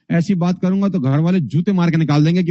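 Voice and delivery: usual speaking voice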